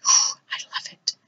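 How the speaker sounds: breathy whispered sigh of delight; a sighed whisper of real delight; soft sigh. Real, not acted. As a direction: breathe it out, admiring the card. Sighs over a pretty finished card.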